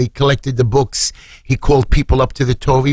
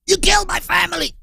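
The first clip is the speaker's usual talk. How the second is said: very high anguished cry; high-pitched, strained outburst; acted scream; 3 dB quieter than usual. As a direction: scream it out as an accusation. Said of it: "started to scream".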